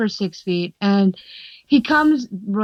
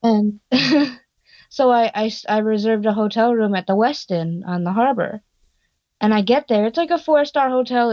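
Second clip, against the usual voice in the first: ordinary talk, nothing marked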